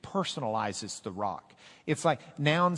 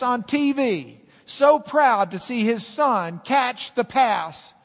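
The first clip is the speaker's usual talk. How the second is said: rising, louder, higher preacher's build; full-force preaching; 8 semitones higher, 9 dB louder than usual. Acted. Pastor tells the congregation of a proud father, preaching loud. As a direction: preach it up to the peak.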